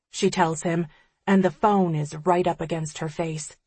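ordinary delivery, nothing marked

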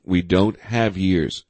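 usual speaking voice

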